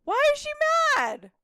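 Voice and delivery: high, loud outburst; loud disbelief. Real, not acted. Streamer hearing the pet is mad, loud disbelief. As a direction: cry out the question, dropping at the end.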